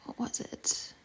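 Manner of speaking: near-whisper, thinking aloud; whispered to self. Real, not acted. Streamer trying to recall what to address, whispered to self. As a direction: ask yourself under your breath.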